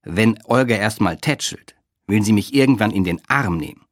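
usual speaking voice

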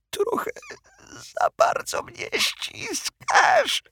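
very high, slow strangled squeak; squeezed breathless. Acted. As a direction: force it out while crushed. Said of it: "wydusił koszmarek".

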